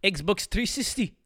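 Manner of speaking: loud, slow Nigerian accent imitation. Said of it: "if you're from Nigeria, people, they say".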